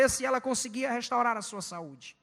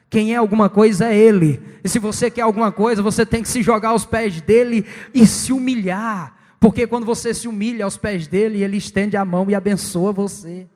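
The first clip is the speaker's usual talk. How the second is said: booming, much louder pulpit exhortation; full-force preaching; 2 semitones lower, 12 dB louder than usual. Real, not acted. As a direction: thunder it out to the congregation. (pregação inflamada, em voz bem alta)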